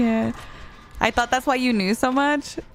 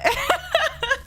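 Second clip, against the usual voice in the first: high giggle. Real, not acted. Laughs at chat.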